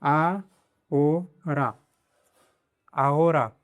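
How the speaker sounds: आ, ओ रा, then आओरा joined up, h silent; आओरा with the h dropped; ahora syllable by syllable. Shown as a demonstration. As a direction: split आओरा apart, then blend it. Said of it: "तो इसको पढ़ेंगे".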